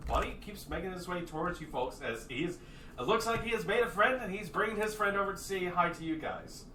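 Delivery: ordinary talk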